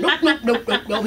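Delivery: lightly voiced bird chanting nopes; little bird impression. Showing how he looked at the end, playful bird impression. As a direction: chant it like a comic little bird, half laughing. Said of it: "That's what he looked like".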